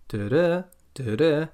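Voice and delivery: second "da" lifted upward; rising intonation. Shown as a demonstration. Teacher imitates the clause ending tune, "da-da" rising. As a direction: hum the tune as "da-da", stepping up. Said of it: "my voice goes up a bit".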